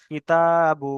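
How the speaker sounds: slow কিতাবু with a long held তা; lengthened middle vowel. Shown as a demonstration. Teacher giving an example of the rule, long তা. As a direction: stretch the "aa" of তা in কিতাবু, keeping কি short. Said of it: "এক কালি পরিমাণ টান দিয়ে পড়তে হবে".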